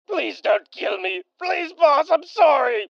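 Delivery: high, loud pleading; begging. Acted. A henchman pleading with the boss not to kill him. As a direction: beg for your life.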